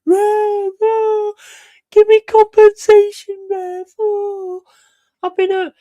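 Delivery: very high, whiny fake sobbing voice; hammed-up crybaby wail to the referee; mock crying footballer. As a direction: whimper like a diving player begging the ref. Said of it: "And he's crying".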